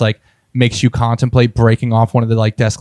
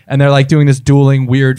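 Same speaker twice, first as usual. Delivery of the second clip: ordinary talk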